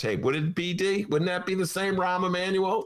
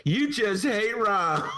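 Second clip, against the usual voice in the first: mock Romano retort; 6 semitones higher, much slower than usual. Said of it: "Romano's gonna say".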